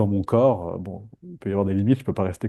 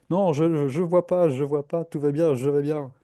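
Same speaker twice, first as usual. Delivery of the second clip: mock denial; 5 semitones higher than usual. Acting someone in denial.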